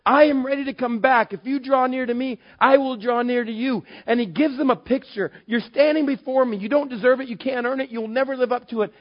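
high, loud impassioned preaching; preaching at full force. Acted. Pastor preaching to his church, high and loud with passion. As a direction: drive the grace message home to the congregation.

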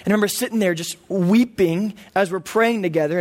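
usual speaking voice

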